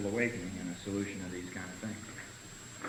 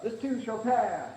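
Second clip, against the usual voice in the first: higher, slow, sugary slogan; mock platitude; 10 semitones higher, slower than usual. Member offering a slogan to a suffering newcomer, mock sugary. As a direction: drag it out like a greeting card. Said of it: "Somebody else come up".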